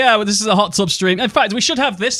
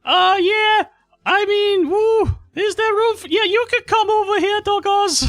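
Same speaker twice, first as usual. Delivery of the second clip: very high mock Geordie hostess; Amaranth. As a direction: welcome them in a put-on falsetto Geordie voice. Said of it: "the sexuality of Geordie Amaranth".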